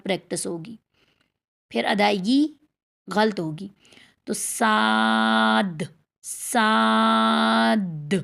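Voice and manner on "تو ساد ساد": long drawn-out thin ساد, mouth spread wide; thin practice sound. Shown as a demonstration. Teacher models the spread-mouth practice step, thin drawn-out ساد. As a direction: hold ساد with a light, flat-tongued s and open aa. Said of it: "جب چوڑا نہیں کریں گے تو automatically منہ خود گول ہوگا".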